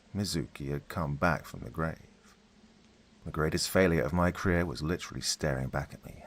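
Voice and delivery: usual speaking voice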